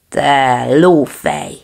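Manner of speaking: loud, slow shout; big dog booming an insult. Acted. Big dog shouts an insult back at the water. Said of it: "kiáltotta a nagykutya".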